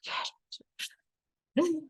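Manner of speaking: soft whispered forget it; resigned. Retold or imitated. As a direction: breathe it out, giving up. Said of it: "And it's just like".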